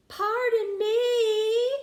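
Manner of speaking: very slow, high, loud polite snake; hissing snake. Acted. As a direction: stretch it out sweetly like a courteous snake. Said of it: "Danny hissed".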